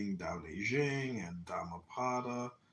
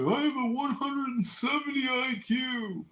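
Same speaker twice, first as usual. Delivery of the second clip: high, loud mocking brag; mock bragging; 8 dB louder than usual. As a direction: ham up a boastful know-it-all. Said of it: "they say".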